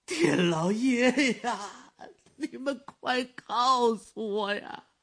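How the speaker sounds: put-on high, slow, anguished cry of an old father; an old man pleading desperately, near tears; frantic old man. Old father begs for news. (焦急哀求的老人)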